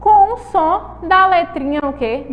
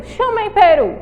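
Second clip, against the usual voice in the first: higher, louder call to the class; 7 semitones higher, 5 dB louder than usual. Shown as a demonstration. Teacher has pupils call the word peru, calling out. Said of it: "Então falem aí a palavrinha peru".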